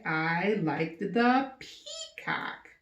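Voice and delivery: teacher showing the peacock option slowly; slow model sentence. Shown as a demonstration. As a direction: lay it out for kids. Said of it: "or you could say".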